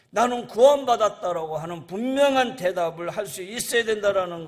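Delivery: loud, urgent sermon questioning; forceful preaching. Real, not acted.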